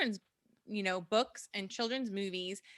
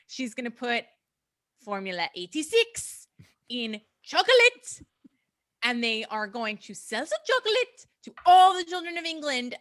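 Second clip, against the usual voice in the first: put-on German accent; 6 dB louder than usual. Telling friends the witch plot in a mild German accent. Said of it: "your German accent".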